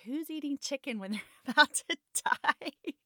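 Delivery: high laughing disbelief. Retold or imitated. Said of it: "I'm like".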